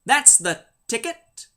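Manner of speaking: put-on catchphrase voice, slow and drawn out; the critic. Quipping about an excuse for misquoters, mock impression. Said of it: "as the critic might have said".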